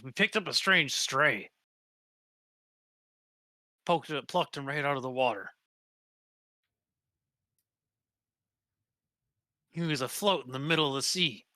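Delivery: lightly voiced sailor telling a sea tale; a hint of a storytelling sailor; sailor recounting. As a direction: recount it as a seaman, measured and emphatic. Game master voices the captive sailor.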